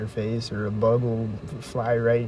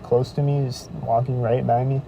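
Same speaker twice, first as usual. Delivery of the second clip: normal talking voice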